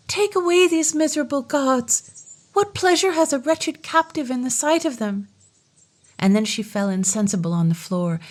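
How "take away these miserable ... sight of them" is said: high, despairing outcry; despairing cry. Acted. Captive princess rejecting the gifts, high despairing cry. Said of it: "In despair she cried".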